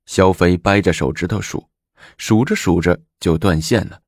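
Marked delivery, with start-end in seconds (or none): none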